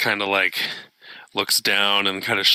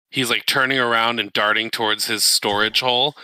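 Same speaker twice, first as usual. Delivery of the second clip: usual speaking voice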